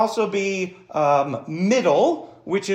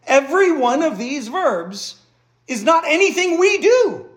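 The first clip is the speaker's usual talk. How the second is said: fervent emphasis; 10 semitones higher, 6 dB louder than usual. Real, not acted. Preacher driving home his point.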